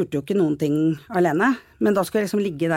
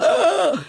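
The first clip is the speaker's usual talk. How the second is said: shocked gasp; 12 semitones higher, 4 dB louder than usual. Retold or imitated. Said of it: "og så bare".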